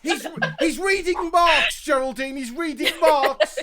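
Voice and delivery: high, loud and laughing; alarmed posh shout. Pretend. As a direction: cry out in mock horror.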